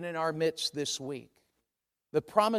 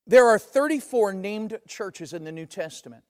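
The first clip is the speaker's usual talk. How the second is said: normal talking voice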